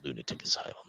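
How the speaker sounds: breathy spooky whisper. Pretend. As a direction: whisper it like a ghost story. Spooky whispered place name.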